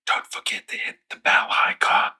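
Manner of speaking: urgent stage whisper; whisper yelling. Acted. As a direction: hiss the plug like a secret. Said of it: "Why are we whispering?".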